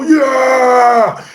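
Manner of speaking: high, shouted whoop; mock whole-room cheer; crowd cheer. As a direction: roar back like an excited audience. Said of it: "the whole room will go".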